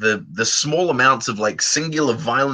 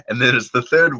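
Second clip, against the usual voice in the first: ordinary delivery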